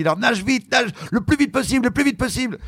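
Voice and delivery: high, urgent order to swim fast; coach's order. Retold or imitated. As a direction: push him on like the coach. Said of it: "Elle me dit".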